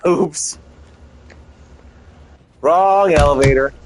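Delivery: ordinary delivery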